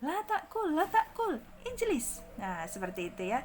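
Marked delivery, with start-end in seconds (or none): 0.0-2.2 "La ta'kul, ijlis" very high, thin and falling; smiling intonation. Shown as a demonstration. Teacher models pleasant-sounding commands, high smiling voice. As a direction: pitch "La ta'kul" way up through a smile. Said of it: "lebih enak didengar".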